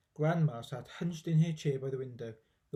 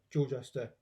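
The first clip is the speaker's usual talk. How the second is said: ordinary delivery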